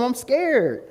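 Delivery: high, mock-scared confession; hammed-up scared squeak; comic fear. Repeating what he told his friend, mock scared. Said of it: "And I literally told him".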